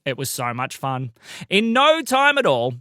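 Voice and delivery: usual speaking voice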